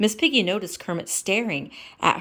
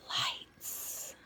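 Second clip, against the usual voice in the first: whispering; 14 dB quieter than usual. Real, not acted. Whispered title.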